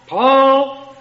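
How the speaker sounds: sharp, loud, annoyed shout of the name. Shown as a demonstration. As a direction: yell it at the kid who took your hammer again. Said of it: "My tone is gonna change".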